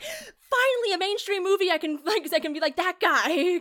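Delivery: laughing fan gushing over his favourite actor; excited fan. Retold or imitated. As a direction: squeal it out like a thrilled fan who finally feels seen. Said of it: "I was like".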